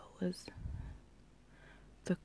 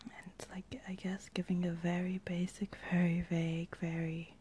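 ASMR whisper. Real, not acted. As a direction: whisper gently, close to the mic. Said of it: "my random A S M R voice".

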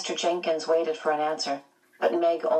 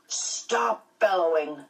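drawn-out stern order from a principal; sharply rebuke, stretching the words; slow, mildly sharp scolding; sharp reprimand; slower than usual. A narrator's reading. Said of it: "Mister Jenkins said sharply".

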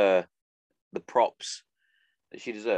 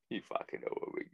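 whispered; 13 dB quieter, faster than usual. Real, not acted. To his listeners, a whispered aside. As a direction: whisper it, unvoiced and soft. Repeats catchphrase in varied voices.

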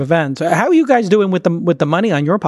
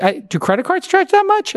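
much higher, mock-incredulous retold question. Host retelling his reply to the lender, incredulous and much higher. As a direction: ask it as if you can't believe the rate. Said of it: "So I was like".

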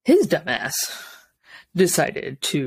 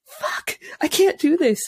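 breaking from hushed to high; mock exasperation; 10 semitones higher than usual. Mom retelling being overwhelmed by screaming kids, exasperated. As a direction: act out being at wits' end. Acts out an overwhelmed mother's despair.

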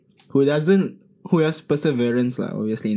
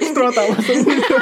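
laughing; 12 semitones higher, 5 dB louder than usual. Real, not acted. Laughing over a joke.

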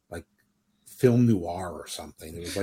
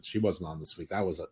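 ordinary talk, nothing marked

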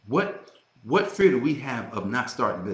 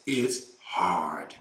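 Les Brown voice; 6 semitones lower than usual. Retold or imitated. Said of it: "in my Les Brown voice".

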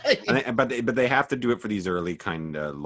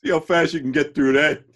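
laughing dad mockery; 7 semitones higher, 5 dB louder than usual.

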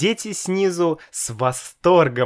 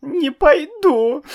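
put-on high, slow, louder childish sobbing refusal; 6 dB louder than usual. A narrator's reading. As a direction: wail it through tears. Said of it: "всхлипывая".

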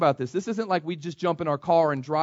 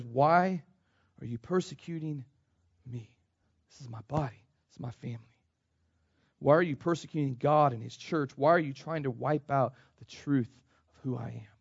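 much softer, hushed pleading Jesus; gentle voice of Jesus; 4 semitones lower, 6 dB quieter than usual. Acted. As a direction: let the Lord ask it softly. Preacher voices Jesus to Saul.

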